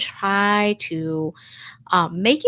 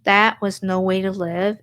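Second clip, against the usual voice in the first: normal talking voice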